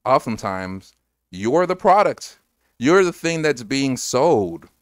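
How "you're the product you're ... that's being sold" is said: high, loud and slow; emphatic warning. Real, not acted. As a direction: drive the warning home.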